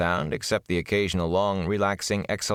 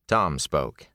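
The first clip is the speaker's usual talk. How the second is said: ordinary delivery, nothing marked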